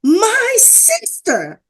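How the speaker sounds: very high, drawn-out hail; stretched, high-pitched mock yell; imitated yell. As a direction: imitate a man calling out to his sister. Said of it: "And I heard again".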